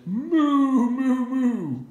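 high loud mooing. Acted. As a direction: bellow moo moo moo. Duck moos.

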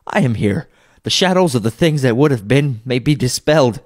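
high, excited exclaiming; overjoyed relief. Acted. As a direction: marvel aloud, swelling with conviction. Scrooge overjoyed to be alive.

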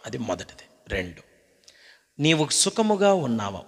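ordinary talk

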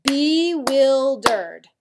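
very slow and loud, "Bewildered" split into three beats; clapped syllables. Shown as a demonstration. Teacher claps the new word along with the class, slow and loud. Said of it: "Clap it out with me".

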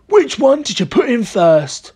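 high, excited character voice; eager cry. A narrator's reading. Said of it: "cried Mister Killycranky".

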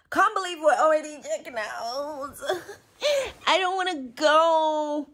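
choked-up laughter over leaving; laugh-crying. Real, not acted. Sad to be checking out.

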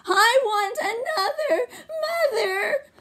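put-on high, wailing baby cry; crying baby mouse. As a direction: wail it like a sobbing little mouse. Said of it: "Baby Mouse started to cry".

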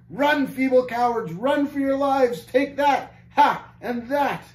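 high, loud battle taunts; triumphant shout. A narrator's reading. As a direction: shout boldly as you land each blow. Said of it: "up yet more courage and shouted".

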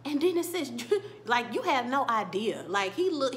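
ordinary delivery

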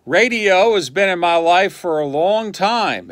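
ordinary talk

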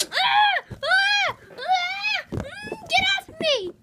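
high playful shrieks of mock panic; pretend screaming. Squealing kid being jumped on by a dog, playfully panicked. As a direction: squeal as if a dog is jumping on you.

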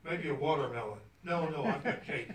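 ordinary delivery

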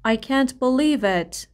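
slow, with weight on "believe"; slow model reading. Shown as a demonstration. Teacher modelling a phrase slowly for students to repeat. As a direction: stretch out "believe" for the class. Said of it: "بگین باورم نمیشه".

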